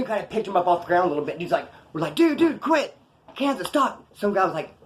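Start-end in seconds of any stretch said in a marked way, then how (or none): 2.1-4.0 high-pitched pleas to stop; urgently trying to break up a fight; retold urgent pleading. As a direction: imitate alarmed bystanders, voice raised in pitch. Retold onlookers urging choker to stop.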